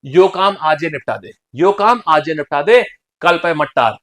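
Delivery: firmer, deliberate Bundeli demo; Bundeli dialect. Shown as a demonstration. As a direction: put on Bundeli village speech. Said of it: "this is Bundeli भाषा". (बुंदेली लहजे में)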